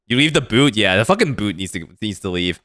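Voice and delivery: ordinary delivery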